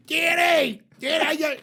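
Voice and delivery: yelling for help across the room; panicked shout. Retold or imitated. Old man yells across the room for his friend, panicked. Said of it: "and he goes".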